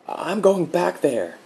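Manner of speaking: high, slow, choked-back words; stifled out. A narrator's reading. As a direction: force it out past a tight throat. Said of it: "he stifled out".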